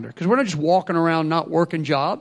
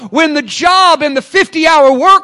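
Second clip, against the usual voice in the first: louder, higher, slowing on key lines; full-force preaching; 6 semitones higher, 9 dB louder than usual. Real, not acted. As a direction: drive it home like a preacher.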